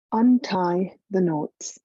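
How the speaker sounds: usual speaking voice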